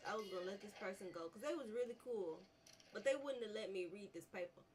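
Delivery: normal talking voice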